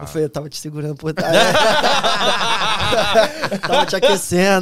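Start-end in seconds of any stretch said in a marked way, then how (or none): 0.2-1.3 words breaking into laughter; laughing. Real, not acted. Confessa rindo ao parceiro. 1.4-3.6 a burst of loud laughter. Real, not acted. Gargalhada após a confissão. 3.6-4.6 louder, said through laughter; laughing. Real, not acted. Continua rindo da sacanagem.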